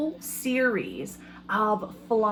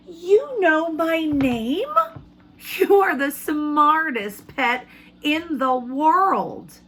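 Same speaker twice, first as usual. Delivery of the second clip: higher, louder, slow and amazed; surprised boy; 7 semitones higher, 5 dB louder, slower than usual. Acted. Teacher voicing the boy amazed the fly knows his name, surprised. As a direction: gasp it out in delighted disbelief. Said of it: "The boy was surprised".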